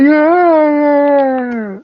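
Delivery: high, long drawn-out creature groan; Sloth. Acted. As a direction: stretch it out as a high, dopey movie-monster yell. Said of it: "It's a good impression".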